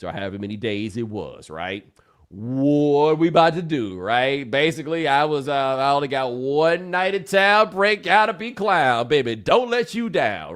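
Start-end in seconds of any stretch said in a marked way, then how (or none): 2.3-10.5 louder, higher, pushy party pitch; swaggering pickup pitch; hyped pitch. Retold or imitated. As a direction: rap out the sales pitch for one night. His pitch to the woman.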